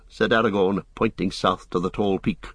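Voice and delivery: usual speaking voice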